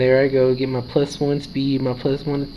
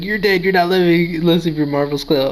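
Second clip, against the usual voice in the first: higher, louder gloating taunt; excited taunt; 4 semitones higher, 4 dB louder than usual. Retold or imitated. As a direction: gloat at the opponent. Taunting the opponent's Pokemon.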